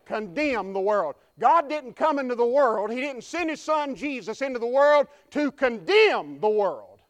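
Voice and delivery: loud, high, driving preacher's cry; preaching at full force. Real, not acted. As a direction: build the line to a ringing peak.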